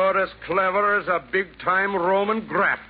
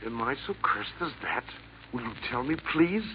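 hushed shaken question; 7 semitones lower, 8 dB quieter, faster than usual. Acted. Assassin shaken by Santa's words.

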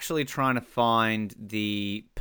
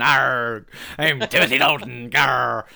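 pirate voice; 4 dB louder, slower than usual. Retold or imitated. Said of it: "There's our Timothy Dalton".

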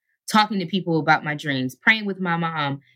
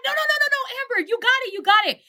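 much higher, bursting with the good news; rapid, pitched-up mock elation; excited news; faster than usual. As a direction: cut her off, thrilled. Agent announcing she booked it.

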